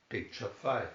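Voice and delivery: usual speaking voice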